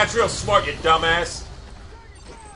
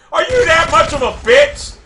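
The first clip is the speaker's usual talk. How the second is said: angry shout; 6 semitones higher than usual. Real, not acted. Gamer raging at a hiding enemy, angry shout. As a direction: snap it out, raised and heated.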